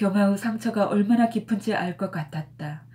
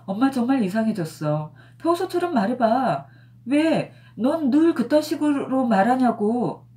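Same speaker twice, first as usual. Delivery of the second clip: higher, louder, slowing at the end; irritated daughter; 6 semitones higher, 5 dB louder than usual. A narrator's reading. Daughter demands mother talk normally, irritated. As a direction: daughter pushes back, exasperated.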